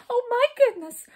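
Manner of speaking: very high, loud wail; miserable wail. A narrator's reading. Jump despairing after Skip, miserable loud wail. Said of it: "wailed Jump in a miserable voice".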